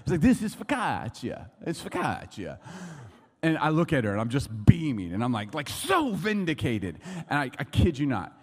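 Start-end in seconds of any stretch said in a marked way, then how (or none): 0.2-2.6 imitating an Italian waiter, slow and emphatic; loud, then settling, Italian-accented repeat; louder Italian waiter. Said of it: "Like, a little louder". 5.7-7.0 stretched, high exclamation of triumph; triumphant. Retold or imitated. Gloating over winning the argument.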